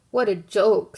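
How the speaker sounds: mocking jeer. Robins mock Toad's kite.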